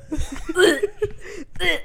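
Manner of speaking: short mimicked retches, amused; imitated stifled gagging. Friend imitating Danny respecting the podcast, stifled gagging. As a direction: act out repeated held-in gags. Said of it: "trying to be quiet".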